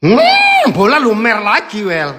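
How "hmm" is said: savoring hum. Real, not acted. Tasting, high hum.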